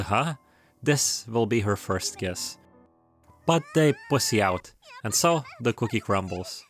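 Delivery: normal talking voice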